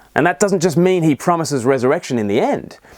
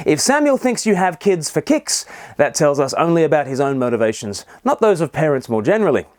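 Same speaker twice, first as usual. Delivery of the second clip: ordinary delivery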